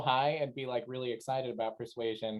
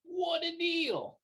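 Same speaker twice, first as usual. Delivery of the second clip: high, drawn-out marvel; mock amazement; much slower than usual. Galan reacts to the recruiter's special, high and drawn out. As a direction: savour it slowly, far too impressed. Galan acts impressed by the offer.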